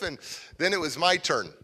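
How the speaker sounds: usual speaking voice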